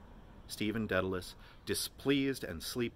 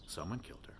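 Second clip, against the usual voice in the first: gloomy; 9 dB quieter, faster than usual. A narrator's reading. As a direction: say it heavy and dark. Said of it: "Stephen said gloomily".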